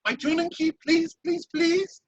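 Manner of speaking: pleading. Acted. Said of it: "most sorrowful, pitiful look ever".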